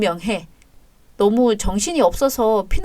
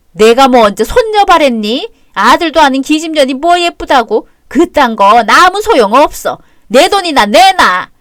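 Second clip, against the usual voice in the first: contemptuous demand; 3 semitones higher, 8 dB louder than usual. Acted. Mother-in-law demanding money.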